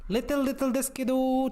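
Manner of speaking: "Little, little" jumped up high, quick and bright; high playful little. Shown as a demonstration. Viewer hears him trying the word "Little" in a high quick voice. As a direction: squeak "Little, little" up high and fast.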